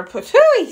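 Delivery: very high, loud spitting sound; spitting patooey. A narrator's reading. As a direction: spit the stew out with a big patooey. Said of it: "said Papa".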